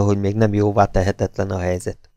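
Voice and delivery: ordinary delivery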